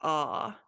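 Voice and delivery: "ah" as the vowel for unstressed O; unstressed O sound. Shown as a demonstration. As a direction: open "ah" in place of a round O. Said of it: "it is pronounced more like ah".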